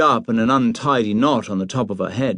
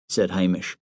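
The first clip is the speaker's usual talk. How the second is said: plain reading voice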